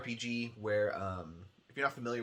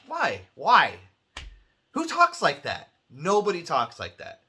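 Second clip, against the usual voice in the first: exasperated rant; 6 semitones higher, 11 dB louder than usual. Real, not acted. Ranting about bad game dialogue.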